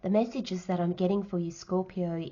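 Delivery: ordinary talk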